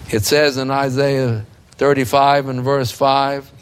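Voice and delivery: normal talking voice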